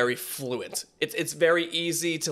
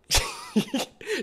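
amazed high laugh. Real, not acted. Laughs at fast flow.

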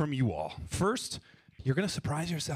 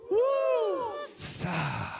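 woosah release. Shown as a demonstration. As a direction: let it all out, woo then sa. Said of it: "Five is a woosah".

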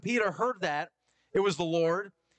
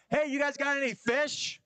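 high call from the shore, voice pitched up; stranger calling out; 10 semitones higher than usual. Retold or imitated. Stranger on shore calling to fishermen, high voice. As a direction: shout across the water to the boat.

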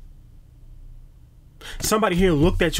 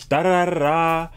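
ordinary talk

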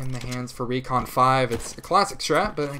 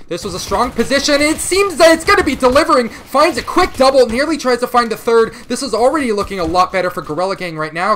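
rising excited call, much louder and higher; 10 semitones higher, 11 dB louder than usual. Real, not acted. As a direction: erupt into a hype call as the kills land. Play-by-play burst after strong position.

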